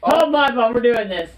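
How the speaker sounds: loud, high hype call; excited shout. Real, not acted. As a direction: shout it like a dare. Gearing up for a dare.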